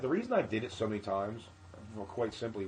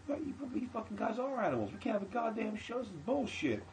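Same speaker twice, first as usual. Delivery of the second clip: high-pitched mock rant, softer than real yelling; ripping into them; 11 semitones higher, 4 dB quieter than usual. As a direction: imitate a worked-up comic scolding the crowd. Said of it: "Kev starts ripping into them".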